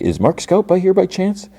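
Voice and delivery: lightly voiced high polite Milchick; Milchick impression. Imitating Milchick.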